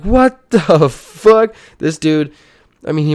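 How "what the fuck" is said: much higher and louder, slow and drawn out, laughing; laughing disbelief. Retold or imitated. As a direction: stretch it out in laughing shock. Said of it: "I was like".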